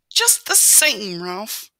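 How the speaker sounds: high, slow, loud start of a reproach; worried mother mouse. A narrator's reading. Mother mouse unconvinced by son's argument, worried reproach. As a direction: caution him in a high mother voice.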